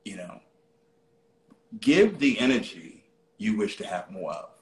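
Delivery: ordinary talk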